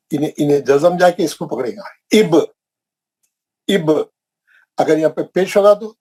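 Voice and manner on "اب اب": اب said as "ib" with zer, ba held still; reading aloud slowly. Shown as a demonstration. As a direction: read اب as "ib", short i then ba joined. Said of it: "یہاں پہ زیر ہوگا تو". (اب کو زیر کے ساتھ "اِب" پڑھنا)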